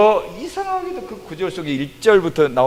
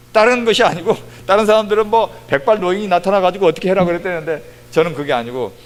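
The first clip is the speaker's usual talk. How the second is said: ordinary delivery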